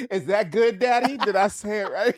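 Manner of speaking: put-on very high, eager-to-please kid voice; mock little child. Coached child asks Daddy for approval, mock childlike. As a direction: imitate a kid checking with Daddy.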